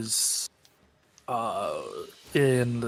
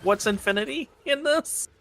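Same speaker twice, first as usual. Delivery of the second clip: sharply higher, disbelieving question; incredulous; 9 semitones higher than usual. Real, not acted. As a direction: ask it in baffled disbelief. Confused at misheard infinity.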